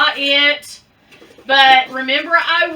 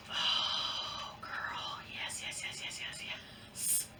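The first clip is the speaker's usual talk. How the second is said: breathless whispered yeses, slowing down; whispered joy, slowing on the last yeses; excited whisper; 20 dB quieter, much slower than usual. Real, not acted. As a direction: hush it out like a delighted secret. Thrilled whisper over the purple bag.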